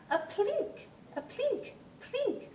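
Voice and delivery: high, bright plinks like plucked strings; plinking fiddle. Acted.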